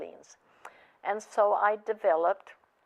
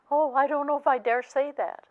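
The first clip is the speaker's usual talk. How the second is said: higher, louder imitation of a daunted wife; retold nervous reluctance; 8 semitones higher, 6 dB louder, faster than usual. Wife hesitating at the counselor's advice, retold nervous reluctance. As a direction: play her balking at the idea, pitched up.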